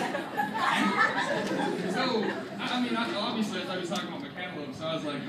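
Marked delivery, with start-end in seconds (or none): 0.0-1.9 long, drawn-out, high-pitched laughing disbelief; stunned disbelief. Retold or imitated. Whole lunch table reacting to friend, laughing disbelief. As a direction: stretch it out, breaking into laughter. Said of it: "whole table ... kinda looked like".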